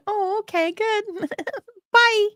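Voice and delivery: high, sing-song fake cheer ending in a laugh; mock-polite brush-off. Answering people who claim princess ancestry, high sugary dismissal. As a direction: brush them off sweetly and leave. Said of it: "so I just go".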